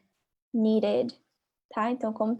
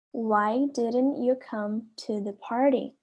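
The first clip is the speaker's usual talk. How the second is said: slow, careful classroom model; slow repeat; slower than usual. Shown as a demonstration. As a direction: stretch it out for the class to copy. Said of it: "A letra A fala assim". (devagar, para os alunos repetirem)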